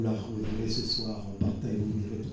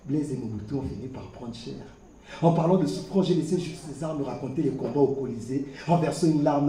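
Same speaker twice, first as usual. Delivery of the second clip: ordinary delivery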